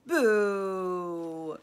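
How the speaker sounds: booming jeering boo; villain rat booing the mercy; loud boo. A narrator's reading. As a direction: jeer at them loudly. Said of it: "shouted Botticelli".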